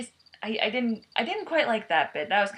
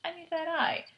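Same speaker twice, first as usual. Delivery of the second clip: a raised mock demand; lightly higher greedy ask; imitating Rocket; 10 semitones higher than usual. Rocket demanding the prisoner's eye, voiced higher. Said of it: "he's like".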